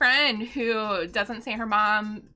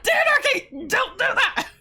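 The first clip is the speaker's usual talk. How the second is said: high, loud laughing call of Dadderkey; embarrassed daughter laughingly telling dad off; laughing scold; 14 semitones higher, 6 dB louder, slower than usual. Retold or imitated. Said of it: "And I'm like".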